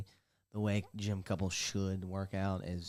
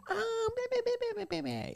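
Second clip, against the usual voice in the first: squeaky, much higher nonsense babble; mocking girl talk. Mocking the gym girl's reply as high meh-meh babble. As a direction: parody her chatter as meaningless squeaks. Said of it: "girl talking".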